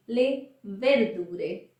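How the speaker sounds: "le verdure" very slowly, ver-DU-re. Shown as a demonstration. Teacher to learners, slowed vocabulary demo. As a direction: spell out "le verdure" syllable by syllable. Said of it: "vocabulary demo".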